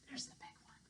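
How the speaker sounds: hushed whisper aside. Acted. Storyteller whispers to listeners about the big goat. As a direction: breathe it in a whisper.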